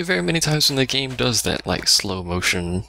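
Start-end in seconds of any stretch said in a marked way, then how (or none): none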